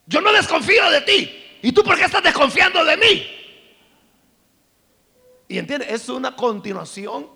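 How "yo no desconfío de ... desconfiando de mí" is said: mock indignant, high and loud; hammed-up turning the blame back; accusing. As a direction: boyfriend accusing his girlfriend, mock indignation. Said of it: "y dice".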